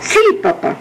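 put-on high-pitched little-boy reply; obedient child. A narrator's reading. Boy agreeing to his father's errand, obedient. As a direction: answer Dad in a small high voice.

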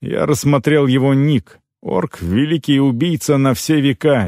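plain reading voice

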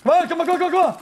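high, loud urgent shouting; being chased. Real, not acted. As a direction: urge them on at full volume. Frantic moment in the fight.

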